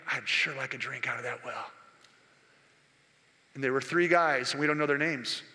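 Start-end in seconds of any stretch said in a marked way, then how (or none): 0.1-1.7 softer and lower, longing; hushed wish; quiet wistful longing; thinking aloud softly. Acted. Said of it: "he's up there thinking".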